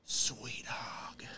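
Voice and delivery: breathy Ace Ventura whisper; lightly done Ace Ventura aside. Retold or imitated. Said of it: "the Ace Ventura".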